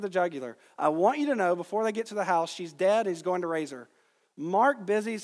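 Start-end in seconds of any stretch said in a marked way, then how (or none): none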